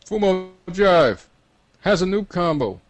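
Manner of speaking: high, loud drawn-out call; loud yell. Acted. Game voice tells everyone who has the combo, loud yell. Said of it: "a loud voice yells to everyone".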